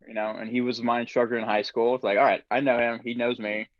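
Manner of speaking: ordinary delivery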